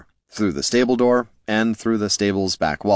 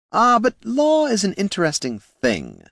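lightly raised, silky voice of a polite schemer; courteous words with a hidden sneer; smooth gnome Flynn; 12 semitones higher than usual. A narrator's reading. Flynn needling the heroes, mock politeness.

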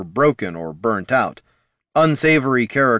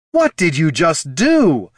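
slow, loud and higher yell; 7 semitones higher, 5 dB louder, slower than usual. A narrator's reading. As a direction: shout it in disbelief, slowly. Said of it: "yelled Loruna".

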